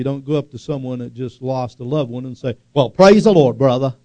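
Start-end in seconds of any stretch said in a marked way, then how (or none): 2.7-3.9 loud, bright mock hallelujah; hammed-up hearty praise at the wrong moment; mock cheery greeting. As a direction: boom it out like a tactless churchgoer. Tactless cheer at a funeral.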